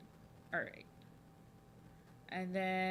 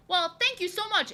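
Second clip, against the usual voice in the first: bright, high, delighted thank-you; excited thanks; 10 semitones higher, 7 dB louder than usual. Real, not acted. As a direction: gush with surprised gratitude. Thanking viewer for compliment.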